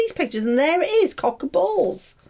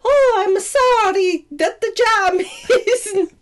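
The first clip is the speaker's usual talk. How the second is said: high, slow mock-Italian apology; Italian accent; 6 semitones higher, 3 dB louder, slower than usual. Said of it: "he got an Italian accent".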